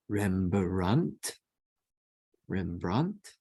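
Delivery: Rembrandt stretched slowly syllable by syllable; slow reading of Rembrandt; slow sounding out. Shown as a demonstration. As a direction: spell it out slowly as you read. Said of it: "sounding out Hebrew spelling".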